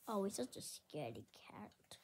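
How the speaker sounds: secretive whisper; hushed teasing whisper; whispered aside. Pretend. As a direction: lean in and whisper it. Aside about scared sheep whispered.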